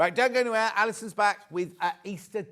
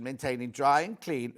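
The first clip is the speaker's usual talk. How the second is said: ordinary delivery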